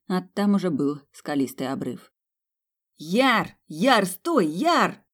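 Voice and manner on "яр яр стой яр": shouting. Acted. Brother shouting after falling man.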